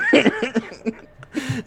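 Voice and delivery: a burst of high-pitched laughter. Real, not acted. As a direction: laugh out loud, amused. GM laughs at nice line.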